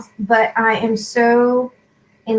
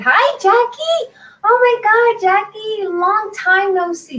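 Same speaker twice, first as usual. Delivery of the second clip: much higher, louder excited hello; excited greeting; 10 semitones higher, 3 dB louder than usual. Real, not acted. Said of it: "Sorry, I'm so excited".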